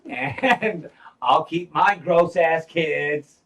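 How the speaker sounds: laughing through the words, slow and loud. Real, not acted. Joking about the queen's kids, laughing. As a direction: crack up while saying it.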